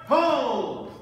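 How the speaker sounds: loud, sharp call to stop; shouted command. Real, not acted. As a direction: bark the halt across the floor. Referee halting fencers.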